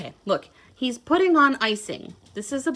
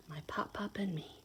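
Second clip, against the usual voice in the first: whisper; 3 semitones lower, 14 dB quieter than usual. Acted. Whispered closing of book.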